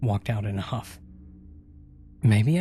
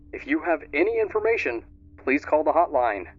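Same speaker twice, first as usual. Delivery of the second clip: higher, crisp TV news read; newscaster; 5 semitones higher than usual. Acted. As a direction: read it like a TV anchor. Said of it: "the newscaster said".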